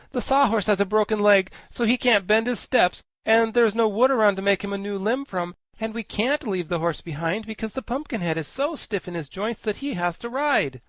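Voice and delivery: put-on high eager boy voice; earnest boy Tip. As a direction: explain it all in one rush like a boy. Tip explains the problem.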